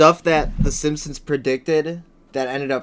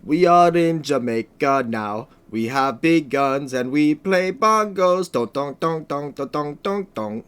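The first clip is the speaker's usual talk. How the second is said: slow, hammed-up Jamaican accent with drum sounds; a Jamaican accent put on slowly as a joke; mock Jamaican accent; slower than usual. Joking about groups in Jamaica, then a mock Jamaican accent. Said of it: "that's better than my Australian accent".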